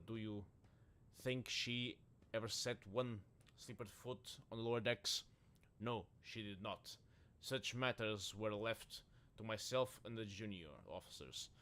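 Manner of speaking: ordinary talk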